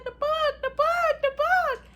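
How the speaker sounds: loud, very high-pitched shriek; hammed-up terror, yelling out each repeat; retold panicked yell. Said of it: "I yelled and jumped".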